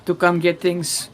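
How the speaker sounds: lightly voiced Russian accent, broken English. Nomad trader pitching to the party, mild Russian accent. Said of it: "by which I mean Russian".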